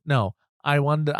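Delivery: ordinary talk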